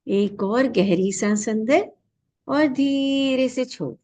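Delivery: slow, stretched words with a held tone; guided breath cue. Real, not acted. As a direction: pace a long breath in and out. (सांस के साथ धीमी, खिंची हुई आवाज़)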